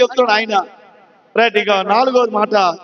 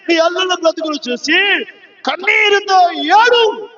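building preaching; 5 semitones higher than usual. Acted.

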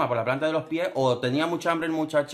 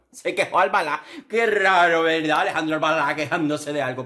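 sarcastic jab; 3 semitones higher, 6 dB louder than usual. Real, not acted. Sarcastic jab at Albalá complaining.

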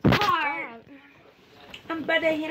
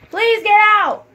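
loud, higher-pitched plea; exasperated raised-voice plea; pleading shout; 5 semitones higher, 10 dB louder than usual. Real, not acted. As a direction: shout it, begging them to leave. Begging someone to leave room.

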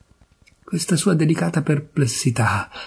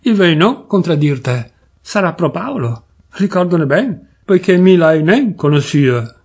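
grudging Turin cousin. Acted. Drunk cousin conceding to the narrator, Turin accent. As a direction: give in grudgingly in broad Piedmontese.

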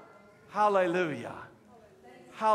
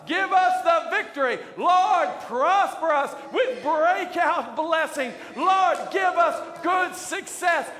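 preaching at full force; 7 semitones higher, 6 dB louder than usual. Acted. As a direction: cry out to heaven and make the crowd answer. Preacher leads shouted prayer.

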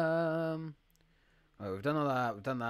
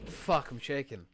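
normal talking voice

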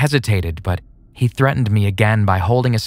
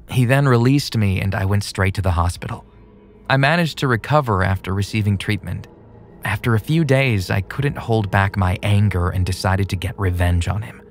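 usual speaking voice

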